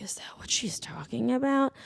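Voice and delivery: unvoiced, hushed question; retold hushed aside; whispered aside. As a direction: whisper it under your breath.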